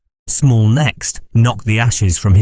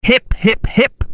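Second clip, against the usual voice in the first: put-on high, wild drunken toast; drunken cheer; slower than usual. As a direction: cheer like a reveller. Delirious toast at his table.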